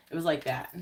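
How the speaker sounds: ordinary talk, nothing marked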